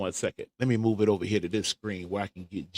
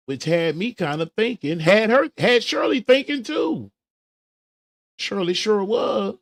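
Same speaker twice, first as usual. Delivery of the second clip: rising excitement; 11 semitones higher, 8 dB louder than usual. Real, not acted. Building excitement about the gossip.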